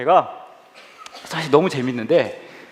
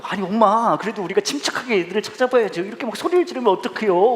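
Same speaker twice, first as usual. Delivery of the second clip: higher-pitched exasperated reasoning; acted pleading without raising volume; urgent hushing plea; 7 semitones higher than usual. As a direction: talk mom down, embarrassed. Son tries to hush screaming mother.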